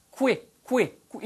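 "qui" as "kwi", lips out then pulled in; isolated, emphatic "qui" with a W; rounded W glide. Shown as a demonstration. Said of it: "입술이 나갔다고 들어오면서".

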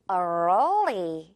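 early as three beats, curled R then L. Shown as a demonstration. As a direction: stretch early into three syllables. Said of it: "세 글자에 가까운". (early를 세 글자처럼)

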